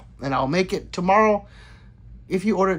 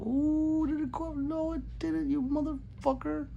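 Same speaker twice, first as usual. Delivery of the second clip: much higher, softer mock squeak; squeaky voice; 12 semitones higher, 6 dB quieter than usual. Streamer checks the sub counter in a high mock squeak. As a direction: squeak it like a cartoon, then curse.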